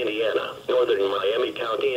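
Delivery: ordinary delivery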